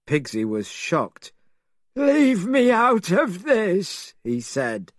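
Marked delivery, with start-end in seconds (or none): 2.0-4.1 high, slow, put-upon pig voice; Pigsy's voice. A narrator's reading. Pigsy begging the girl's father to leave him out, high whiny voice. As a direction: squeal it out, wanting no part of it.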